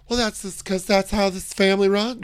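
high, affected camp dad voice. Retold or imitated. Reality-show dad explaining his family, high camp voice. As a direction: pitch it high like a camp patriarch.

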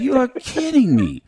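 high, loud cry of disbelief; stunned disbelief. Retold or imitated. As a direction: shout it like you can't believe who's here. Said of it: "I said".

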